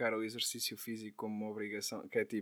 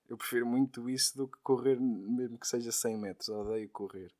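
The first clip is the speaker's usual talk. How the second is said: ordinary delivery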